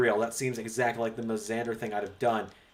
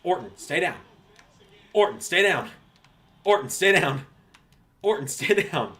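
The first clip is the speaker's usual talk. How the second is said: playful command; 5 semitones higher, 5 dB louder than usual. Pretend. Calling out at game opponent.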